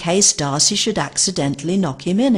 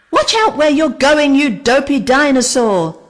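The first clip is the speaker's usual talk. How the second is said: high, loud furious yelling; angry yell; 8 semitones higher, 7 dB louder than usual. A narrator's reading. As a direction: shout her down from the water. Creature knocked into lake.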